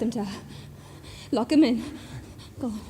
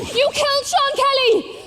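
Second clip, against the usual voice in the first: furious accusation; 7 semitones higher than usual. Acted. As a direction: throw the accusation at him. Repeated accusation higher.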